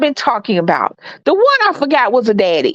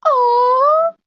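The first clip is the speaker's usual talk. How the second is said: slow, rising coo; 11 semitones higher, much slower than usual. Real, not acted. Mom says hi to the baby in a slow coo.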